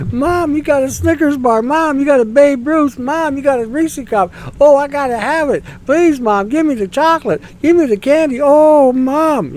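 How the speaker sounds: put-on high, loud whiny kid begging for sweets; begging child. Mocking voters as a kid begging mom for chocolate, high whine. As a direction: whine and beg like a kid at the candy counter. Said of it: "it's like kids begging for candy".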